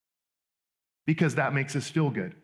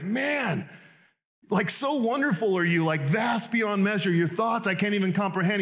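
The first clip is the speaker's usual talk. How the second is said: higher, awestruck, the vast line slowed; blown away; 6 semitones higher than usual. Retold or imitated. Psalmist overwhelmed by an infinite God, awed. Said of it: "He's like".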